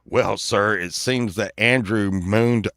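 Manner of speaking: low, measured cop voice; mock-official lawman explaining; police officer. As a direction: report it solemnly to a parent. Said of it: "and they're like".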